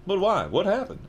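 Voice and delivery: high-pitched, pleading questions; urgent. Acted. Harry asking about his parents, high and urgent. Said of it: "asked Harry urgently".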